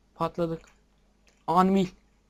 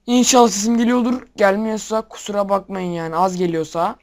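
talking loud; 2 semitones higher, 8 dB louder than usual. Real, not acted. Worried the mic is too quiet.

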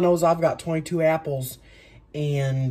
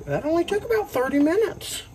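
sarcastic; 3 dB louder than usual. Real, not acted. Joking about slow coring.

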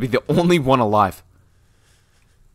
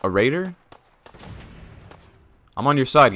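ordinary talk

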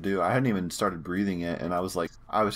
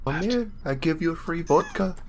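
put-on high, wheedling Russian accent; mock Russian accent; 8 semitones higher than usual. Luring someone with free vodka, mock Russian accent. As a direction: coax them over as a hammed-up Russian. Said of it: "The accent's fucking getting me".

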